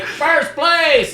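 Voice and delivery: high, loud proclamation; contest announcer. Retold or imitated. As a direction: announce the winner to the whole room.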